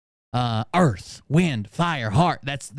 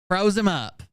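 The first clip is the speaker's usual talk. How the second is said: normal talking voice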